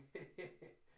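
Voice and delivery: soft, quick tittering; happy giggle. Retold or imitated. Two happily skipping along, soft acted giggle. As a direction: giggle lightly as you skip.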